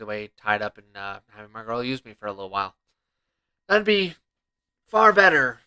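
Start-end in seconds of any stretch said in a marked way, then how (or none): 3.7-5.6 loud, higher and insistent; loud emphatic. Real, not acted. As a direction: push it out with emphasis.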